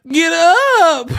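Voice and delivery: high-pitched yelled squeal. Retold or imitated. Streamer mimicking a rapper yelling at a fallen man, high squealed yell. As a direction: shriek it in a drawn-out falsetto. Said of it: "I'm gonna be like [name]".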